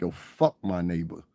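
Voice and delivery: low, soft grumble under the breath; muttered aside. Retold or imitated. Grumbling to himself about his neighbor, low and soft. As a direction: mutter it like a private thought. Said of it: "where I'm like".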